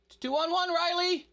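high, loud frustrated call; yelling. Real, not acted. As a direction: shout it to a teammate. Gamer yelling at teammates.

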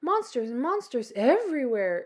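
ordinary delivery

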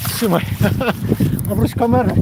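ordinary talk

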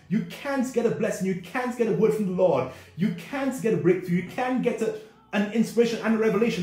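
ordinary talk

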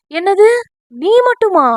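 high, loud startled question. Acted. Friend startled that love is one-sided, high and loud. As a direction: react as if jolted. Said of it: "என்று அதிர்ந்து நோக்கினான்".

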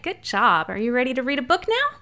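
normal talking voice

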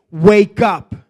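loud, commanding cry of wake up; a preacher voicing Jesus, loud and commanding; commanding call. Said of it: "Jesus is talking to you today and saying".

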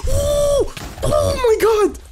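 very high, loud shriek; startled scream. Real, not acted. Player attacked by charged mobs, startled scream. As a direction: scream in sudden fright.

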